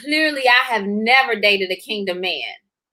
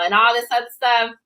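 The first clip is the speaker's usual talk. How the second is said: usual speaking voice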